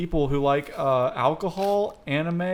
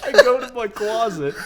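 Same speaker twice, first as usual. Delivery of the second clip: laughing; 8 semitones higher, 7 dB louder than usual. Real, not acted. Laughing mid story.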